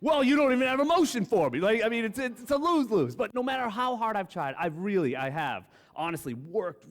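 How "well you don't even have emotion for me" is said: much higher, louder, faster complaining wife; hammed-up wife whining he sounds cold; mock wife complaint. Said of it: "then you talk like that".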